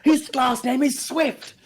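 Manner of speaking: high-pitched, strained comic yell; mock outraged shout. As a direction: cry out in hammed-up exasperation, stretching the last word. Acted outburst of chasing his son.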